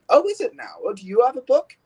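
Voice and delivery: high, loud bickering comeback; squabbling engine raising the pitch; challenging retort. Acted. Acted quarrel between rival engines.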